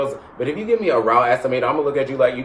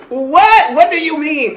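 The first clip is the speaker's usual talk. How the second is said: high, loud incredulous what; shocked disbelief; 13 semitones higher, 6 dB louder, slower than usual. Real, not acted. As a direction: act scandalised. Mock outrage over pizza preference.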